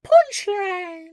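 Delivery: high, loud anime title shout; over-the-top anime cry; anime title call. Retold or imitated. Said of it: "they're like".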